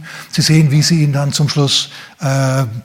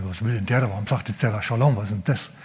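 lower, softer muttered bafflement; puzzled disciples; 5 semitones lower, 7 dB quieter than usual. Acted. Disciples baffled by the stranger's greeting, low and soft. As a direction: puzzle over it under your breath. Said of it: "Und jetzt sagen sie".

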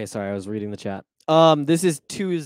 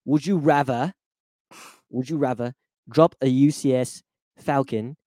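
hammed-up British reading; British accent; 4 dB louder than usual. Shown as a demonstration. Redoing a listener question for the co-host, British accent. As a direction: read it out in mock British, pausing between phrases. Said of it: "I gotta say it in a British accent".

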